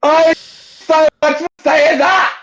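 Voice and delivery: loud high outburst, drawn out; shout. Real, not acted. Arguing with co-hosts over saying it, shouting. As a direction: yell it out in protest.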